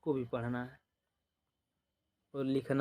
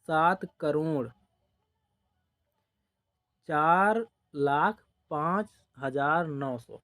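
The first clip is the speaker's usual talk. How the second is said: slow dictation; slower than usual. Shown as a demonstration. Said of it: "तो इसको कैसे लिखेंगे".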